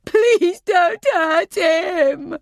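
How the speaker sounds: high, loud, drawn-out tearful wail; anguished crying plea; desperate shout. A narrator's reading. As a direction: shout after him, begging through tears. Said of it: "I shout at his back".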